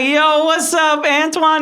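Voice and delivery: high, slow, stretched "Yo, what's up"; greeting back. Retold or imitated. Boy answering his friend's greeting, stretched and high.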